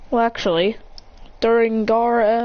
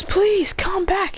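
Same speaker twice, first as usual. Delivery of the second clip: high-pitched plea; pleading; 12 semitones higher, much slower than usual. Acted. Naruto begging someone to return, pleading. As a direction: beg in a raised, higher voice.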